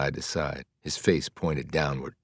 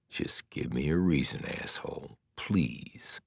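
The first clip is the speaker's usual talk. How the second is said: muttered threat; 2 semitones lower, 7 dB quieter, slower than usual. Acted. Mars's thought about the guard.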